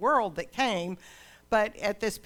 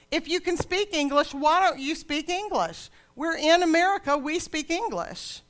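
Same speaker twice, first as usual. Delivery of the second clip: loud, higher, scolding snap at strangers; indignant demand; 8 semitones higher, 5 dB louder than usual. Retold or imitated. Daughter at the craft fair scolding the Navajo women, loud and indignant. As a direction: throw it at her like an entitled scold.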